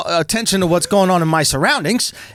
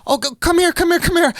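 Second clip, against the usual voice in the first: high, fussing imitation of a girlfriend; girlfriend's urging; 9 semitones higher than usual. Girlfriend calling him back after impact, high mock concern. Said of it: "And my girl goes".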